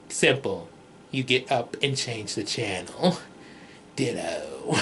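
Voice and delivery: low, soft deadpan monotone; bored deadpan, low and quiet; Raven's low tone. Retold or imitated. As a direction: sound unimpressed, voice dropped low. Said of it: "that kind of low speaking tone".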